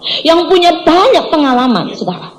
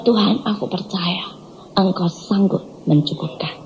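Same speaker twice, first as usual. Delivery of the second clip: low, soft and slow; hushed prayer; 7 semitones lower, 9 dB quieter than usual. Retold or imitated. Preacher telling God she trusts Him, hushed and slow. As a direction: pray it quietly, with steady trust.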